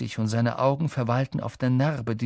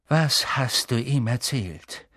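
ordinary delivery, nothing marked

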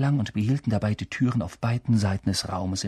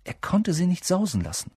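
ordinary delivery

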